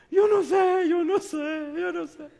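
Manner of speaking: high wailing sobs, loud at first and then fading; imitating his sobbing. The crying man answers the pastor, high sobbing wail. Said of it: "Y sigue llorando".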